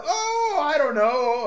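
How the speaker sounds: high, loud mock wondering; sarcastic uncertainty. Host estimating the flag's years, exaggerated mock uncertainty. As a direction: swoop way up in feigned doubt.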